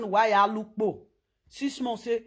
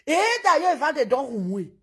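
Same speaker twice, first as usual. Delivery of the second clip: ordinary delivery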